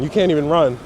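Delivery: ordinary talk, nothing marked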